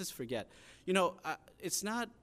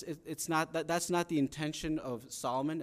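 ordinary talk

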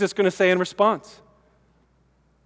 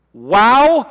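loud, high "Wow" of pure astonishment; jaw-dropped "Wow"; amazed wow; 5 semitones higher, 8 dB louder than usual. Shown as a demonstration. Preacher acting out Jesus' jaw-dropped amazement. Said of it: "he is jaw-dropped".

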